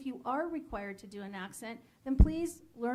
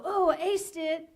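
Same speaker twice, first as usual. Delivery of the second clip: high, loud, drawn-out gloating; mock triumphant boast; 5 semitones higher, 7 dB louder than usual. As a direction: imitate an overconfident actor, slowly. Actor boasts after a failed audition.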